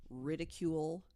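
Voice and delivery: ordinary delivery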